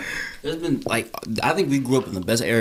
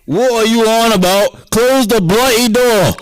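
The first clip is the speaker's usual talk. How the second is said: shouted British outburst, higher, louder, slow; hammed-up angry Brit, close the bloody door; mock British yell; 11 semitones higher, 6 dB louder, slower than usual. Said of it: "bloody wanker".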